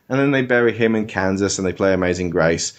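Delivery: usual speaking voice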